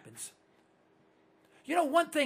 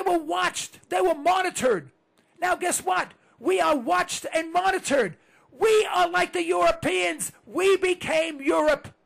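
louder, higher angry shouting; 6 semitones higher, 5 dB louder than usual. Real, not acted. Host shouting at listeners about lost freedom. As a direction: rail at them with fury.

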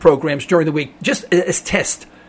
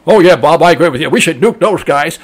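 lower, louder dim-witted caller; mock a clueless call-in listener; a hint of a loud, dopey talk-radio caller; dumb caller; 4 semitones lower, 4 dB louder than usual. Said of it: "sound like absolute abject idiots".

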